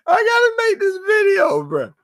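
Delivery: high, squealing laugh through the words; can barely get it out for laughing; laughing hard. Real, not acted. Vlogger cracking up at his own bit, laughing.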